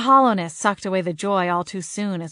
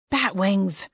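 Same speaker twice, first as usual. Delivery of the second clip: plain reading voice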